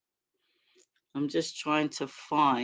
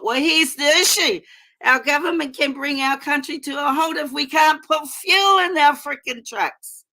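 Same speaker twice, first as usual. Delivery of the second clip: loud, raised ranting protest; angry shout; 8 semitones higher, 5 dB louder than usual. Real, not acted. Livestream host yelling at government fuel policy. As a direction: fire it at the government like a rally cry.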